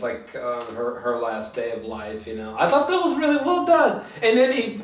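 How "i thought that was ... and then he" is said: laughing. Real, not acted. Excited recalling Thor's mom scene.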